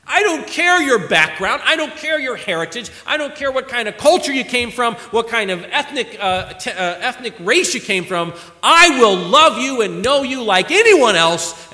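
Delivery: rising pulpit fervor; fervent preaching. Acted. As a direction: preach it out to the congregation. Preaching at full force.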